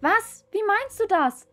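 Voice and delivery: horrified cry. Acted. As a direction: cry out in horror. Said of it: "rief Mohnherz entsetzt". (entsetzt aufschreien, viel höher und lauter)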